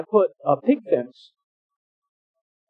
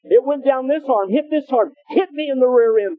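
higher, breathless, rattled retelling; a man still reeling from the jolt; shocked babble; 8 semitones higher than usual. Retold or imitated. Helper tells the villagers what hit him, high and rattled.

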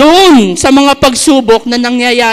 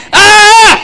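high loud mock yell of a possessed man; imitated scream; 9 semitones higher, 6 dB louder than usual. Preacher acting out the possessed man's scream, a loud mock yell. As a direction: belt out a short cry for the tormented man. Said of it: "sumisigaw yung lalaki".

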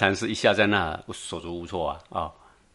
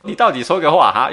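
exasperated outburst; 8 semitones higher, 7 dB louder than usual. Retold or imitated. Monk's frustrated demand retold.

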